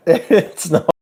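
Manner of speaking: high, giggling laugh spilling into the words; real laughter breaking up the retelling. Real, not acted. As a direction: crack up while trying to get it out. Laughing as she retells the mix-up.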